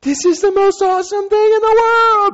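very high-pitched ecstatic squeal; exhilarated mock yell. Skydiver in freefall after being scared, exhilarated high yell. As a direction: squeal it with giddy abandon. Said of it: "went from really scared to".

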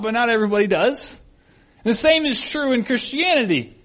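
normal talking voice

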